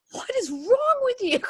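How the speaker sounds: very high, incredulous squeal; mock outraged squeal. Wife reacts to husband wanting Marley and Me, mock outraged squeal. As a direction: squeal it in hammed-up disbelief.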